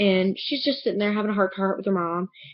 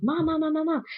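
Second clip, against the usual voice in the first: frantic calling; 7 semitones higher than usual. Retold or imitated. Panicked calling for mom.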